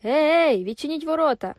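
loud, high call through the night; shouting. A narrator's reading. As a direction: yell at the closed gate. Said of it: "Хтось крикнув".

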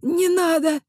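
hoarse, strained plea; hoarse wheeze. Acted. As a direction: wheeze it out, choking. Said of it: "прохрипел Уэйверли".

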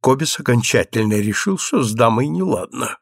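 plain reading voice

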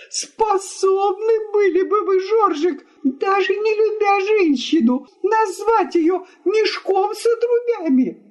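very high, wailing appeal; tearful indignation. Acted. Wife asks guest about the insult, tearfully. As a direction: plead in an outraged, tearful high voice.

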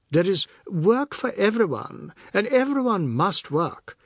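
high, earnest lecturing voice; lecturing Oad. A narrator's reading. Oad lecturing the family on Russia, earnest. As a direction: preach the Soviet gospel.

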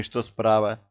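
usual speaking voice

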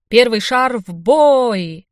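loud, very drawn-out game host call; booming slow proclamation; showman's announcement. A narrator's reading. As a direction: launch the draw, stretching the words. Host launches the lottery draw.

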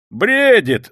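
high, loud cry; tipsy shouting. A narrator's reading. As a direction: shout it out tipsily. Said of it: "закричал хмельной Разумихин". (хмельной крик)

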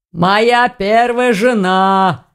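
very slow, stretched-out orc voice; hoarsely chortling orc. A narrator's reading. Orc joking about his first wife, hoarse chortle. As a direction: drag it out with rough amusement. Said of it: "хрипло хохотнул мужчина".

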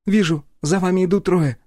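calm sniper on the radio; female sniper voice. A narrator's reading. Polina answers by radio. (голос Полины по рации)

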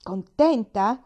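loud scornful echo of the word; old man losing patience with the girl; exasperated outburst. A narrator's reading. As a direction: spit the word back in disbelief. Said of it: "Sbottò Pendleton".

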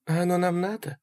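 high, soft female voice; gentle high-pitched question; Sally asking. A narrator's reading. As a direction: ask lightly in her high voice. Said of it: "спросила Салли".

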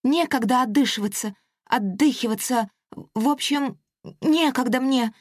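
high, breathless rush; hurried pixie. Acted. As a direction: blurt it out in a hurry. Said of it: "выпалила Пушинка".

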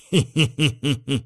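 string of short hee-hee chuckles; giggle. Acted. Narrator after promising Sanya's help, giggling. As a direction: giggle at what is coming.

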